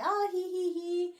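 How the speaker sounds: high playful giggling, a light mock titter; imitated giggle. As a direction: giggle it out like a smitten girl on a piggyback. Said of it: "they're just giggling up a storm".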